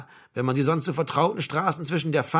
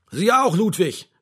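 raised, higher sergeant's yell, short of roaring; bellowed order; 4 semitones higher, 5 dB louder, slower than usual. A narrator's reading. Sergeant major to the idle bus driver, bellowing. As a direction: bellow at him like a drill sergeant. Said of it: "brüllte der Hauptfeldwebel ihn an".

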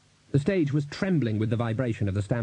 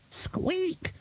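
tiny high squeak; rat squeak; 5 dB quieter than usual. A narrator's reading.

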